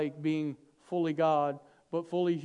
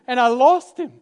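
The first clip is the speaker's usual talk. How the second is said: laughing; 6 semitones higher, 13 dB louder than usual. Acted. Parent laughing at losing Jesus.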